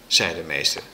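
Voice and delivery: plain reading voice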